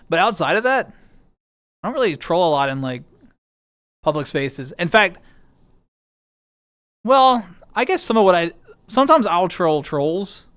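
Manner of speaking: ordinary delivery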